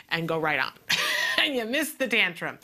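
ordinary delivery

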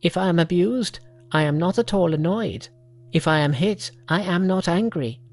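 high, odd little monkey voice. Acted. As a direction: speak as an eager young monkey.

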